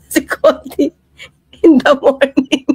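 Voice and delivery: breaking up with laughter mid-sentence; laughing. Real, not acted. Joking about Conti to viewers, laughing. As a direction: laugh through the mocked phrase. Laughs while mocking the phrase.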